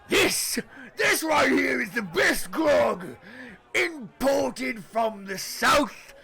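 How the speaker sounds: booming barkeep. Acted. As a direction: boast the grog grandly. Barkeep sells the grog.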